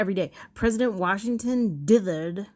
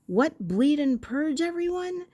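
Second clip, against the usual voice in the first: crowd heckler cry; 9 semitones higher, 4 dB louder than usual. Retold or imitated. Heckler calls out to the doctor, shocked and high. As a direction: cry out in disbelief, pitched up. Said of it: "a voice cried out from the crowd".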